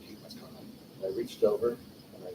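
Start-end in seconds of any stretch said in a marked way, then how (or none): 0.0-0.6 hushed curious whisper; softer puzzled whisper from a friend; whispered reply. Retold or imitated. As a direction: whisper back wondering what's up. Friend answers the secret.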